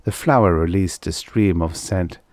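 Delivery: usual speaking voice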